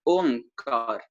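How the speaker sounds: ओंकार drawn out slowly, stopping just before the ग sound; slow correct form. Shown as a demonstration. Teacher correcting a common mistake, slow careful demo. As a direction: say ओंकार slowly, no full ओंग. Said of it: "ओंग नहीं बोलना".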